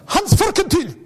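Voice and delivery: put-on boy standing up to proudly announce his name; imitating the classmate. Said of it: "hat er gesagt".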